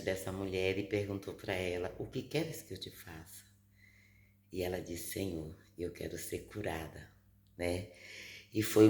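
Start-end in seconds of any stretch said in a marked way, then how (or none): 2.0-7.1 hushed, gentle retelling of a dialogue; soft quoted exchange. Retold or imitated. Retelling Jesus and the healed woman to viewers, softly. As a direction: lower the volume for the quoted question and reply.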